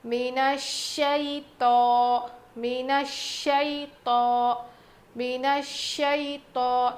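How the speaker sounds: الشیطان with a heavy, full-mouthed ط; الشیطان, fill the mouth on the ط; heavy ta in الشیطان recitation. Shown as a demonstration. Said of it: "تھوڑا موٹا پڑھیں گے، منہ بھر کے".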